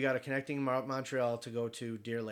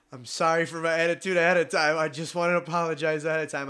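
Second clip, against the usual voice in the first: forced apology; 2 semitones higher, 6 dB louder than usual. Retold or imitated. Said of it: "Go ahead then".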